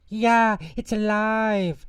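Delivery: very high, loud shriek, stretched slow; terrified scream. Acted. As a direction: scream as if the doll came alive. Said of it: "The lady screamed".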